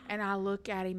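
ordinary talk, nothing marked

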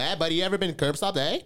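high, loud mock Canadian accent. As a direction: put on a cartoonish Canadian accent. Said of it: "This is fucking Canadian accent".

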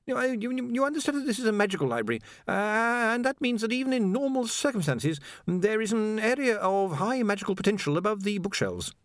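high, stammering anxious scholar; a jittery young wizard stammering high; nervous wizard. A narrator's reading. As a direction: stumble over every word, flustered. Ponder explains, flustered.

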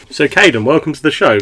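ordinary talk, nothing marked

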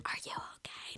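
voiceless whisper, imitating a worried onlooker; hushed check-in through glass; whispered concern. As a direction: mouth it almost silently. Onlookers mouth concern through car windows.

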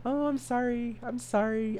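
squeaky-high sorry after laughing; high-pitched apology. Real, not acted. Podcaster recovering from sprinkler joke, high-pitched apology.

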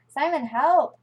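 shout for help. Acted. Alvin falling, calls for help.

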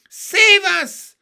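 high loud desperate cry. Acted. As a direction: shriek for rescue. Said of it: "cried Miss Spider".

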